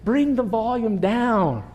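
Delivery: put-on a dad telling his kids to pipe down; parent hushing kids. Preacher imitating himself at home quieting his kids, slow and stern. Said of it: "I have to say this a lot at our house".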